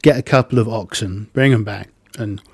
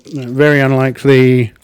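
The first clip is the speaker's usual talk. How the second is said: ordinary talk, nothing marked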